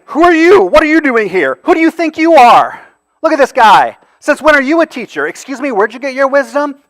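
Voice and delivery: high, loud sneering questions; mocking. Preacher imitating the crowd jeering at Jesus, high and loud. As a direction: jeer at him, high and loud. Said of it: "They're mocking him".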